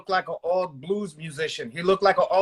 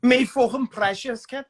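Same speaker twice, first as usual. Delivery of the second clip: Scouse Smeagol; 4 semitones higher than usual. Retold or imitated. Guest mocks Gollum as a Scouser, in a Scouse accent. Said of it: "Schmeagle sounds like he's Scouse".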